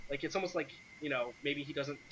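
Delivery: ordinary talk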